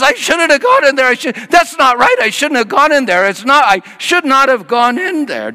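high, loud rattled babbling; a man stunned by what he saw; high, loud shaken voice; shaken friend. Retold or imitated. Guy shaken after.

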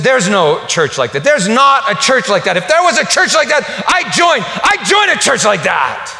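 high, loud, incredulous shout; Harry bursts out he'd join such a church; astonished outburst. Retold or imitated. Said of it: "He said".

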